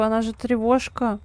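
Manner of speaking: ordinary talk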